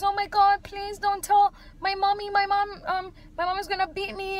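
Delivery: high-pitched imitation of a scared child; mock frightened pleading. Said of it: "[name] is a little actress".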